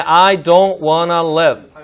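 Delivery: each word drawn out, "live" with a lax i; slow short i demo. Shown as a demonstration. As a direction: say it very slowly word by word, "live" like "lev". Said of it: "이렇게 한번 발음해 보겠습니다".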